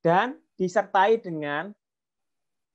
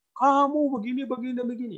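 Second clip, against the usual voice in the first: higher, preachy lecturing voice; lecturing parent; 8 semitones higher than usual. Retold or imitated. Parent lecturing child face to face, preachy. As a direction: wag a finger at the kid. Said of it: "juga nggak bisa seperti ini".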